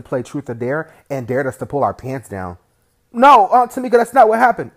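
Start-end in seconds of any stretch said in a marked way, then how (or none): 3.1-4.7 much louder, higher pleading denial; a boy pleading loudly to his aunt that it's a lie; desperate loud protest. Acted. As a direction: yell the denial, begging to be believed.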